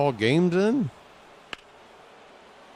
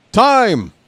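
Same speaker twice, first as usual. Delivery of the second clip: sudden high loud call; umpire calling time; 11 semitones higher, 11 dB louder than usual. Retold or imitated. Imitating an umpire calling time mid-pitch, high and loud. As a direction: bark it like an umpire stopping play. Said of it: "some ump just pops out and goes".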